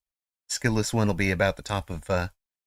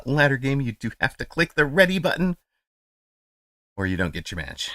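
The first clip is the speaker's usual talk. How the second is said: normal talking voice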